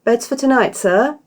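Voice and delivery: high, bright innkeeper welcome; professionally bright. A narrator's reading. Innkeeper greeting new guests, bright and high. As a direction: put on a bright professional smile. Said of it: "professionally bright smile".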